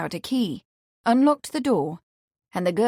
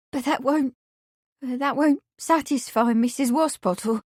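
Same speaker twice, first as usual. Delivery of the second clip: higher, faltering, close to tears; half choked; 5 semitones higher than usual. Acted. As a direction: stumble on the words as the throat tightens. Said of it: "half choked".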